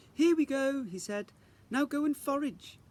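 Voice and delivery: teacher rallying the class; cheerful fairy teacher. Acted. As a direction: announce it with grand enthusiasm. Teacher in the woods.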